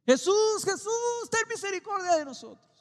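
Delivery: clamor muy agudo y fuerte; grito desesperado. Acted. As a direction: grita como un ciego que suplica. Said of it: "empezaron a gritar". (los ciegos le gritan a Jesús, suplicando a gritos)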